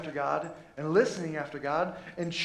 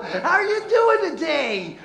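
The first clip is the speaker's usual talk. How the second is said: much higher, louder, slow and laughing; hammed-up Barney greeting; 11 semitones higher, 8 dB louder, slower than usual. Shown as a demonstration. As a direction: stretch it out like a cheery kids' show dinosaur. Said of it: "There we go".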